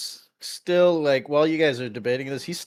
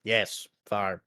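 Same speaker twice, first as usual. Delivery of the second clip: low, soft, absent reply; dazed; 6 semitones lower, 5 dB quieter than usual. Acted. Meepo answers the party about the farm, dazed. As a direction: answer as if lost in a daze. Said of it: "he's, like, in a daze".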